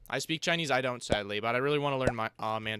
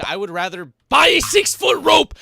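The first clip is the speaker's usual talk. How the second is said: much higher, louder hammed-up yell; mock despair shout; 9 semitones higher, 12 dB louder than usual. Streamer rejecting a Rocket League suggestion, mock yell. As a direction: throw it out as a comic overreaction.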